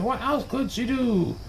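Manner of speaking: ordinary delivery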